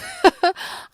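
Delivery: high embarrassed giggle. Real, not acted. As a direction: giggle shyly. Laugh after first kiss.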